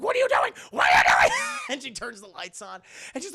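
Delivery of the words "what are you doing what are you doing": loud, shouted, escalating protest; hammed-up outraged yelling; shocked scolding. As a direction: shriek in dismay at someone ruining it. Grandmother shocked at interruption.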